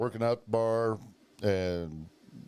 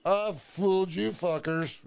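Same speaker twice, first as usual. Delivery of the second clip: gloating John; 9 semitones higher, slower than usual. Retold or imitated. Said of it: "he's like".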